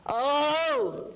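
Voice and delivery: high, loud released Oh; voiced release. Shown as a demonstration. Client adding voice while exercising. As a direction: let the Oh ring out. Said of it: "the voice comes out".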